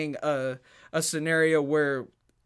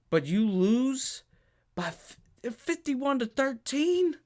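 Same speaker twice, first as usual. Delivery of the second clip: incredulous; 6 semitones higher, slower than usual. Real, not acted. Incredulous at the blowout score.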